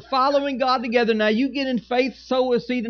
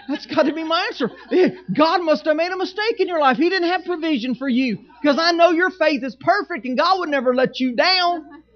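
heavy sarcasm, higher and louder, with a laugh; sarcastic; 5 semitones higher, 3 dB louder than usual. Pretend. Pastor mocking complainers with loud sarcasm. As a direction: pretend to blame yourself, dripping irony. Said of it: "sarcastic reply to complainers".

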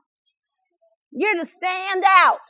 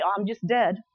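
mock deadpan; 9 semitones lower, 6 dB quieter than usual. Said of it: "We would call ourselves the walking dead".

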